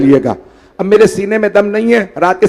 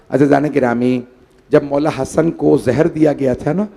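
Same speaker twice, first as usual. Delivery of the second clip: usual speaking voice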